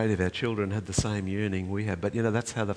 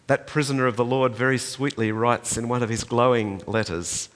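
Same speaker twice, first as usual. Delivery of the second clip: ordinary talk, nothing marked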